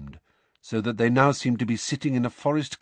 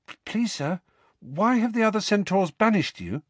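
higher, stammering schoolgirl question; a timid girl asking, higher and unsure; hesitant girl; 11 semitones higher than usual. A narrator's reading. Parvati timidly asking the centaur teacher, higher voice.

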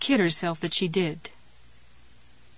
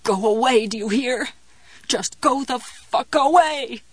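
desperate pained yell; 3 semitones higher than usual. Acted. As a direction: beg the pain to stop. Fighting the cramp, pained outburst.

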